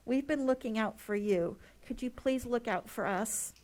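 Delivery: normal talking voice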